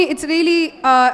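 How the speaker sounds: ordinary talk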